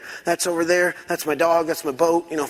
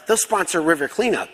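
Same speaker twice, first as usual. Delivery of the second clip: normal talking voice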